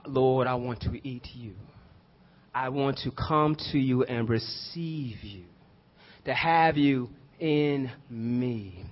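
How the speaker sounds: soft, low prayerful voice; hushed prayer. Acted. Preacher voicing a prayer to the Lord, hushed and slow. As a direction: pray it quietly, slow and intimate.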